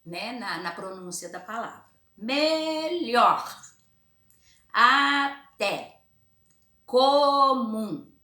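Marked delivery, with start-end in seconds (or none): 2.1-8.1 "Melhor, até, comum" word by word, higher and louder, syllables drawn apart; "Melhor", "até", "comum" loud and high, split by syllable; stressed syllable stronger. Shown as a demonstration. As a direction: stretch each word so "lhor", "té", "mum" stand out. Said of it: "a gente vai falar ela mais forte".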